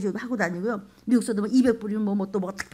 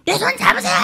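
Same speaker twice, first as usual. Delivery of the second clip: much higher, loud cry reaching for a drowning man; urgent rescue shout; 12 semitones higher, 6 dB louder, faster than usual. Acted. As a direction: yell it out, arm outstretched.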